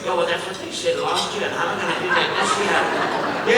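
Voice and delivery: lightly voiced grumbling inner doubter; sceptical inner voice. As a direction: play the doubting little voice in their head. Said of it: "That little voice ... that's going".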